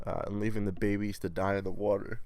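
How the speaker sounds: usual speaking voice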